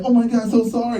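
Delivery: put-on high apologetic girlfriend voice; high mock girlfriend apology. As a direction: squeak out a quick sorry.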